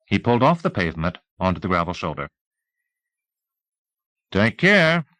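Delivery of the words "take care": sheriff voice. A narrator's reading. Teasel voiced, higher.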